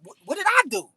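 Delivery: retold high confused protest; mock innocent surprise. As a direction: act wounded innocence. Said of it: "I'm like".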